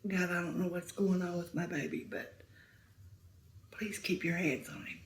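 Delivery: soft, hushed plea to God; quiet prayer. Retold or imitated. Mother praying for her son, soft and hushed. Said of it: "I just started praying".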